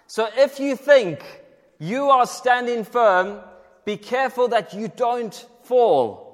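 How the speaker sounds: emphatic warning. Real, not acted. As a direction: preach it hard, slowing on standing firm. Preacher warning congregation.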